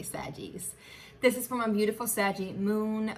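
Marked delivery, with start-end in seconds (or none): 0.0-0.7 breathy whispered nickname; soft whispered aside. Real, not acted. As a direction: whisper it like a secret. Intimate aside to viewers.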